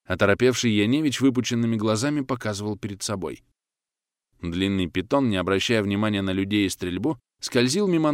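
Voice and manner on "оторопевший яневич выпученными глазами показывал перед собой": usual speaking voice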